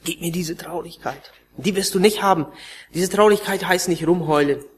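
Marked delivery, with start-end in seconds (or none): 0.0-1.3 softer, offhand mock prayer; glib mock prayer. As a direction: toss off the prayer without meaning it. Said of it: "Es kommen strahlende Gesichter rein".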